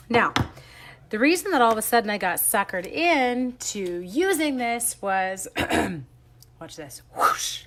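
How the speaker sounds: usual speaking voice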